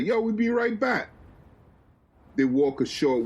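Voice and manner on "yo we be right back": yelling back. Acted. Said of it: "Will yells back".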